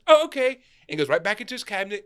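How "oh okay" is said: put-on high, loud little-kid reply; imitated child's bright okay; young son agreeing. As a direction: chirp it back like an agreeable child. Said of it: "He goes".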